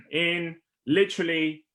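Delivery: losing her voice, hoarse and rough. Real, not acted. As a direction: push through a hoarse throat after clearing it. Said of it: "I'm losing my voice".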